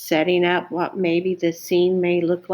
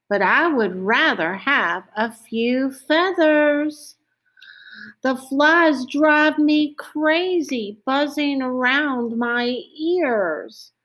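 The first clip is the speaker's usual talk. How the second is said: higher-pitched storybook donkey, drawn-out complaint; pestered donkey; 7 semitones higher than usual. Acted. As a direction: complain as a fly-plagued donkey, pitched up and stretching the words.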